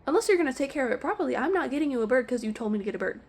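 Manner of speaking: high, rapid-fire indignant rant; outraged retort. Retold or imitated. Pet store worker venting the retort meant for a customer, outraged. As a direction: fire it off fast in exasperated disbelief.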